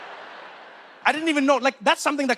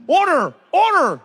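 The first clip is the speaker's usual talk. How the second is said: loud, high courtroom shout; judge calling order; 8 semitones higher, 7 dB louder than usual. Acted. Judge to a noisy courtroom, shouted call.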